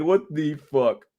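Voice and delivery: drawn-out, dumbfounded what the fuck; slow stunned mock. Streamer voicing a baffled kid in a meme, slow dumbfounded drawl. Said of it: "He's like".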